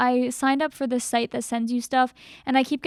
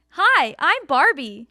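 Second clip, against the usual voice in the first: put-on slow, high-pitched girly doll voice; high girly cartoon-doll greeting; Barbie; 5 dB louder, much slower than usual. Shown as a demonstration. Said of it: "I have, like, a high voice".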